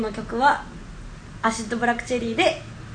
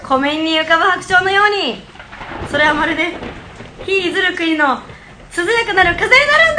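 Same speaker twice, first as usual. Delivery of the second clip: grand poetic coaching; 4 semitones higher, 5 dB louder than usual. Acted.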